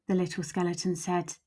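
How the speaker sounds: ordinary delivery, nothing marked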